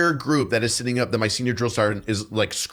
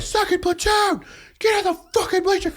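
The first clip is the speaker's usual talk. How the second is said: very high yelled command; furious order. Retold or imitated. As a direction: bark it at the whole platoon. Senior sergeant ordering platoon.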